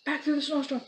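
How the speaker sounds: high, loud hurried panic; frantic. Acted. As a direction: rush it in a panic. Frantic run home from bear.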